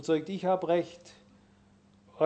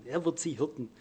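"Er wird sie hirten" repeated much softer; 2 semitones lower, 9 dB quieter than usual. Shown as a demonstration. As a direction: drop to a quiet echo of "hirten". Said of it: "er sagt hier relativ einfach".

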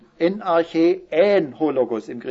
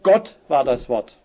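Gott hit loud at the front, then slower; stress on Gott; 4 dB louder than usual. Shown as a demonstration. Preacher stressing "Gott" to his congregation, slow and loud. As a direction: lean hard on "Gott" and let "war das Wort" follow. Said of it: "weil es eben gerade die Betonung ist".

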